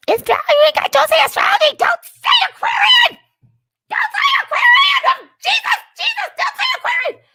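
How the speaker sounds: very high, shouted church lady; shrieking church lady. Pretend. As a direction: shriek the ban like a zealot. Mocking the church lady again.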